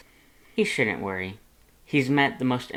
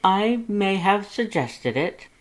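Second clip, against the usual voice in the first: a sheepish, higher, slower Papyrus; admitting it, higher and slow; 9 semitones higher than usual. A narrator's reading. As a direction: own up with a drawn-out high voice. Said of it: "Pyrus admitted".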